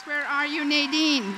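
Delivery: loud call across the room to find her. Real, not acted. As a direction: project it, looking for her in the crowd. Calling out for Nadine in hall.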